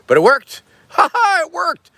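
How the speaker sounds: high, laughing cry of triumph; real glee that the begging worked; delighted. Real, not acted. Streamer thrilled his begging paid off, high and laughing. Gets a super chat, delighted.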